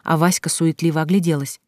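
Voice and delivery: ordinary delivery, nothing marked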